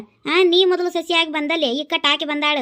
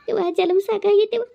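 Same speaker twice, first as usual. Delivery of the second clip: higher, weepy wish for death; tearful lament; 5 semitones higher than usual. Acted. Widow tells friends she wishes she had died, weeping and higher. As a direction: cry out that life is too much.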